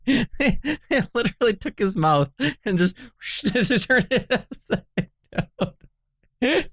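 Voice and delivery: words squeezed out through laughter; barely holding back the giggles; laughing while talking. Real, not acted.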